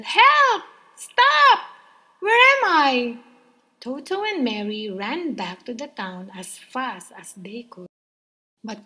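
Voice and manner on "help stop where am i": very high, loud dazed yelling; panicked cries. A narrator's reading. Crocodile crying out after being knocked over. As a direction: cry out, tumbling and lost. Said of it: "cried the crocodile".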